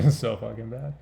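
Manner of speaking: laughing through the words; real laughing. Real, not acted. Vlogger reacting to own troll comment, laughing. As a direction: crack up while saying it. Cracking up at own dark joke.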